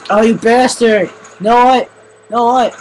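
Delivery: loud high angry shouting; angry yell. Real, not acted. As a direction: shout your fury at the attacker. Furious at an attacker in game.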